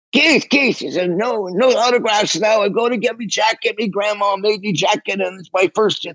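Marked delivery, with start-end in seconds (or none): 0.1-0.8 high, urgent mock call; retold screaming. Calling the drummer as he passes, high mock scream. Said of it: "I screamed". 1.1-6.1 high, agitated British voice. Retold or imitated. Drummer brushing off a fan, high agitated impression. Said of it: "I'm not doing it that great".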